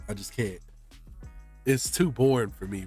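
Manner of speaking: usual speaking voice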